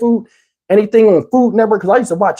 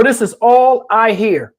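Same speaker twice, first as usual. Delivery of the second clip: ordinary delivery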